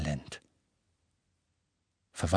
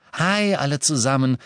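higher-pitched cheerful greeting; happy call; 6 semitones higher, 4 dB louder than usual. A narrator's reading. Luna greets her friends on arrival, happy. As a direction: call out happily to the group. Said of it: "rief Luna glücklich".

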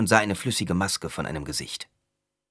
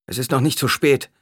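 raised, urgent doctor; 7 semitones higher, 6 dB louder than usual. A narrator's reading. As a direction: call out urgently. Doctor rushing in.

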